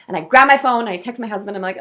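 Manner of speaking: ordinary talk, nothing marked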